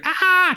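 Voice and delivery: high short mock scream; mock animalistic scream. Host imitating the victim's animal scream. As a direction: yelp a wild scream. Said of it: "just scream".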